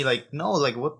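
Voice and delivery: normal talking voice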